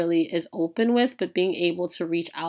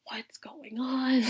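soft, high breathy whisper of concern; gently whispering what's going on; hushed worried friend; 7 semitones higher, 6 dB quieter, much slower than usual. Retold or imitated. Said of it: "she's just like".

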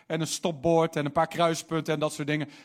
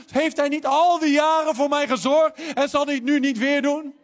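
higher, louder rhetorical questions; preaching at full force; 6 semitones higher, 5 dB louder than usual. Acted. (krachtig preken met retorische vragen)

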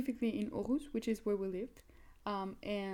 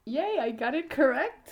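louder, higher victory cheer; excited cheer; 4 semitones higher, 7 dB louder than usual. Real, not acted. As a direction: celebrate getting it right. Wins the quiz question.

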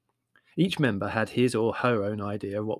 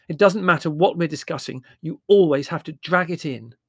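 louder, higher prim complaint; 6 semitones higher, 8 dB louder than usual. Acted. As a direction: scold primly. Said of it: "said Marlowe primly".

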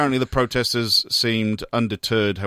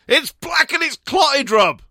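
high-pitched, loud mimicry of a ranting protester; mock outraged shout; 4 dB louder than usual. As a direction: shout it like an alarmed crank, hammed up. Mimics the protester's clip in mockery.

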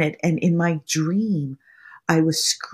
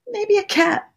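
squeaky cat voice; 4 dB louder than usual. Pretend. Playful cat aside.

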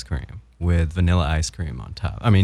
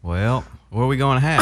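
put-on higher, Southern-accented kid asking a parent; 5 semitones higher than usual. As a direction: play your younger Southern-accented self. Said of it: "like a really Southern accent".

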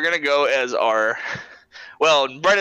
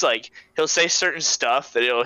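ordinary talk

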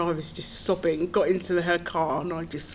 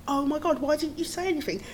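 high, shocked outburst; shocked dismay; 8 semitones higher, 4 dB quieter, faster than usual. Retold or imitated. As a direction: gasp it out in dismay, pitched high. Volunteer lady hears the news.

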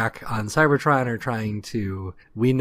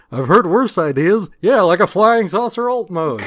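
loud, raised heckler's voice; Statler and Waldorf; 7 semitones higher, 8 dB louder than usual. Acted. Cracking a joke in a standoff, loud heckling. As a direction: call out the joke like hecklers in the balcony. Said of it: "another fucking Statler and Waldorf joke".

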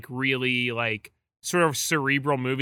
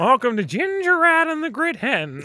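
put-on high, quavering old wizard voice; frail wizard; 11 semitones higher than usual. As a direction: wail it like a feeble old wizard. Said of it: "It sounds like a, a frail wizard".

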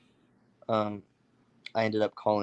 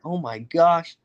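amazed; 8 semitones higher, 5 dB louder, slower than usual. Retold or imitated. Friend amazed by the deer.

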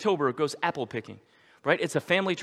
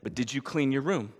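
patient father; 4 semitones lower, 5 dB quieter than usual. Retold or imitated. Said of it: "And he says".

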